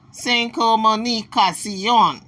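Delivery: slow, drawn-out mock Spanish accent. Translating for Spanish speakers, mock Spanish. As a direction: stretch it out in playful Spanish. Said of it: "Well, you speak Spanish?".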